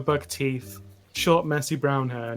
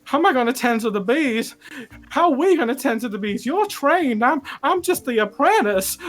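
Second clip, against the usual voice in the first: high, loud, frantic and rising; babbling in rising panic, near fainting; panicked apprentice; 8 semitones higher, 4 dB louder than usual. Acted. GM voices panicked beekeeper NPC.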